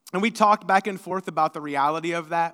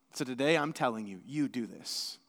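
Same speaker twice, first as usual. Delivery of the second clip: quiet emphasis; 8 semitones lower, 9 dB quieter than usual. Real, not acted.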